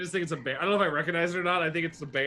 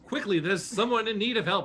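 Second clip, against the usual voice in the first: higher-pitched urgent rallying cry; urgent call; 6 semitones higher, 4 dB louder than usual. Acted. Hero looks back to companions, urging them on. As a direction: urge the party to hurry.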